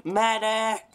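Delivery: high, loud call for help; man down shout. Real, not acted. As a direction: yell for the medic, urgent. Calling for medic when downed.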